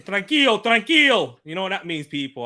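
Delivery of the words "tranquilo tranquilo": mock Simeone. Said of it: "He's saying".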